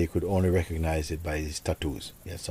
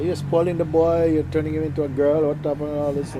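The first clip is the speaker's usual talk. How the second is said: high, louder mock scolding of a stern uncle; 9 semitones higher, 5 dB louder than usual. Uncle scolding the father about hugging the boy, mock scolding. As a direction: tell the father off for spoiling the boy. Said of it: "My uncle didn't like that".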